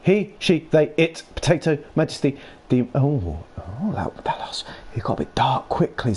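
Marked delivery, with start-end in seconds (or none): none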